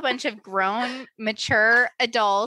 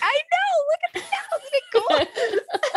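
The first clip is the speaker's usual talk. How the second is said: delighted. Retold or imitated. Parent pointing out cows to the kids, high laughing delight. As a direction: marvel at the cows like a kid.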